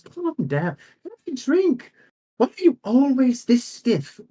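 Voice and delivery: high, coaxing tease; teasing noble lady. Acted. Noble lady teases the stiff guard, high and playful. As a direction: loosen him up, pitched well up.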